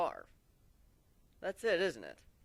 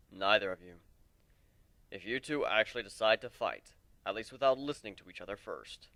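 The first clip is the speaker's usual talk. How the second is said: put-on a lower, weary old warrior voice; lightly lowered voice of a weary mentor; lower voiced warrior; 5 semitones lower than usual. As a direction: speak as the old lone warrior, regretful. Old warrior Aoi speaking.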